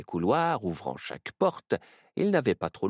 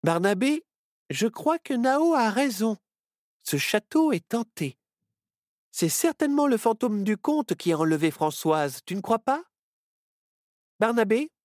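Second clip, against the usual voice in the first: Myrtille talking; 5 dB louder than usual. Acted. Myrtille speaks to Barnabé.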